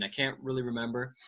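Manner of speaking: usual speaking voice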